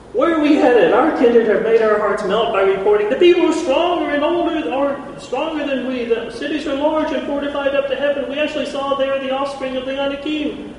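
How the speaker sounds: high, loud alarmed pleading; panicked people. Retold or imitated. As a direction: sound the frightened crowd. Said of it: "the only thing they see is bad".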